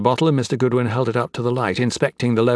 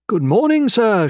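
much higher, lilting imitation of a girl; modest flower girl; 11 semitones higher, 5 dB louder than usual. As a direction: imitate her modest greeting up high. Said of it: "with that modest look of hers".

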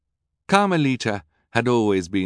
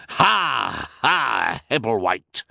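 mild mock crowing; a touch of villain triumph; crowing laugh; 3 semitones higher, slower than usual. A narrator's reading. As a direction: crow it out smugly. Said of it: "Olaf crowed".

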